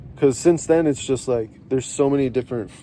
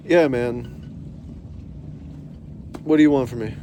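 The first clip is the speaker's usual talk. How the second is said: usual speaking voice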